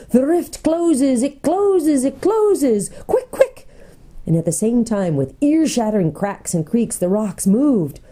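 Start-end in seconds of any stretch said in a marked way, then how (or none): 0.1-3.7 high, drawn-out salamander warning; shrieking hiss of living fire, urgent. A narrator's reading. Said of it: "a hissing, scorching voice like the voice of fire itself".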